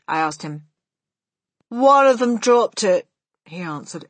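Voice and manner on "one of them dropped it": lightly voiced brash boy, louder and higher; loud-mouthed boy. A narrator's reading. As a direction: give Bruno a loud, offhand voice. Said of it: "rather loud-mouthed Bruno".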